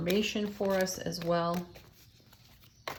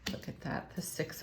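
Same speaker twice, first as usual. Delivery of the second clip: soft amazed whisper at the card; hushed whisper; 9 dB quieter than usual. Real, not acted. Reader whispering in awe at the drawn card. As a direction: breathe it out in a whisper.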